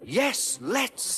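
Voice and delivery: very high, eager cry; excited high engine. A narrator's reading. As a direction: answer with eager glee. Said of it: "said Percy excitedly".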